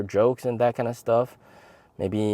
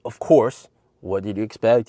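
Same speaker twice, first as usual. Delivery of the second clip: normal talking voice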